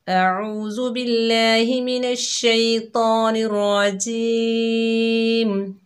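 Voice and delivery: slow, drawn-out tajweed chant; measured Quranic recitation, long held vowels. Shown as a demonstration. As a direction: recite each phrase slowly, melodically. Recites opening verses in tajweed.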